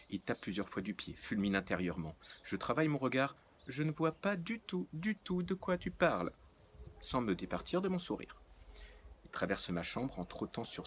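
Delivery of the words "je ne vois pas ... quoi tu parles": high, airy denial; sweetly playing dumb; feigned innocence. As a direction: act like butter wouldn't melt.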